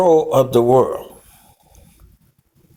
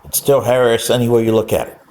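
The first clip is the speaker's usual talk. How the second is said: usual speaking voice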